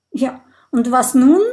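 loud, mildly desperate question. A narrator's reading. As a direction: ask it at a loss, voice pushed up. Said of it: "fragt Mathilda verzweifelt".

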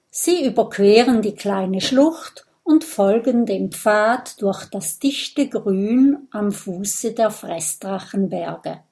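ordinary delivery, nothing marked

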